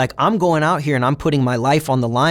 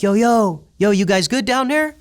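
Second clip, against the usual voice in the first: yelling down the tier; 9 semitones higher than usual. Retold or imitated. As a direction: holler it down the tier. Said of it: "they're yelling down".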